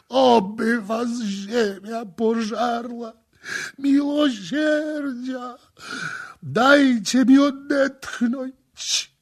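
very high, loud, sobbing wail; agonized screaming. A narrator's reading. Victim under hot tar begging priests, agonized cries. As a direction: scream for mercy in drawn-out agony.

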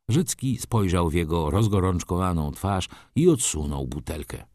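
ordinary delivery

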